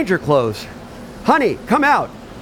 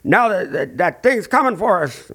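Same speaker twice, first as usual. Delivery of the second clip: put-on higher, frightened old grandmother's voice; spooked grandma; 6 semitones higher than usual. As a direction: play the grandma who is rattled by the scratching. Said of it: "your grandma here is starting to freak us out".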